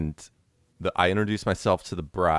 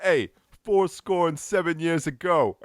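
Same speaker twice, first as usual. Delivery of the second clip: high, slow mock holler; hammed-up old man's heckle, pitched way up; imitated old heckler yelling; 10 semitones higher than usual. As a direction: yell from the back like an old guy. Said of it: "some old guy from the back yelled".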